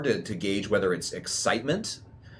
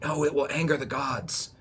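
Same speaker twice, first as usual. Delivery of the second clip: lightly voiced objector, higher and slow; slightly raised, drawn-out protest; protesting crowd member; 5 semitones higher, slower than usual. Game master voices a worried crowd member, mild protest. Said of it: "But some people are like".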